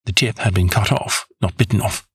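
usual speaking voice

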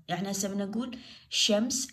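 ordinary delivery

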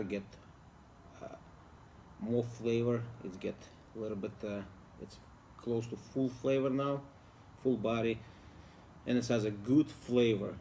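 talking around a cigar; cigar at mouth. Real, not acted. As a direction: lips near cigar. Seen 0: Holds cigar at lips through most of the labelled speech.

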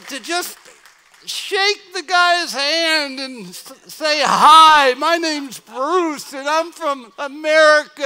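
loud, high pleading prayer; crying out in prayer. Retold or imitated. Missionary crying out to the Lord, loud and pleading. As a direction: beg the Lord aloud for one chance. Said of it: "I cried out to the Lord".

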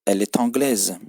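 "Elle est anglaise" with the T of "est" linked onto "anglaise", e-tanglaise; French liaison. Shown as a demonstration. Teacher demonstrating liaison to Turkish learners, linked T. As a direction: link "est" and "anglaise" with a sounded T, no break. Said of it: "bakın ulama yapılıyor E'deki T ile".

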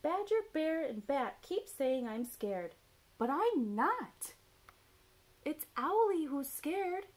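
high, loud indignant owlet; swooping, emphatic storybook protest; Baby Owl protesting. A narrator's reading. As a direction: complain in a high little owl voice. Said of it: "said Baby Owl".

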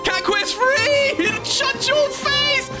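high, drawn-out hype shout; excited shout. Real, not acted. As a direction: yell it out in pure hype. Hyped at Cat Quest reveal.

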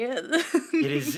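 real laugh breaking in. Real, not acted. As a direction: laugh it out, caught off guard. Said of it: "This is so spooky".

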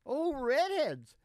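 ordinary talk, nothing marked

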